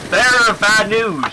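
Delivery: high, loud mock-grim announcement; dramatic announcer. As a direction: deliver it like a doom herald. Announcing bad news dramatically.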